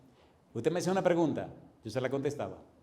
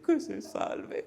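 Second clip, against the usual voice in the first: high, slow, whispered sister's plea; sick sister's answer; 10 semitones higher, 4 dB quieter, much slower than usual. Retold or imitated. Pastor voicing the dying sister's answer, high slow whisper. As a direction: breathe it out high and slow like a frail old sister. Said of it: "la hermanita dice".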